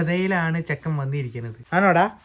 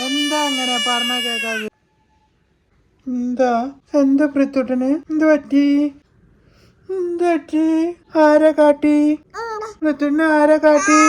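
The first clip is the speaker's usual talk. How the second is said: very high singsong questions; 12 semitones higher, 5 dB louder than usual. Real, not acted. Father soothing crying baby. (കരയുന്ന കുഞ്ഞിനോട് കൊഞ്ചിച്ചു ചോദിക്കുന്നു)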